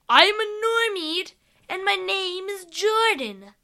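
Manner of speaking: high squeaky creature introducing itself. Acted. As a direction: chirp the name out like a little creature. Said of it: "said the creature".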